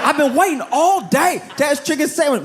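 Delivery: high-pitched, frantic indignation; outraged customer. Acted. Customer complaining after hours in line, outraged. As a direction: act out a customer losing it, voice shooting way up. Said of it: "people were losing their minds over it".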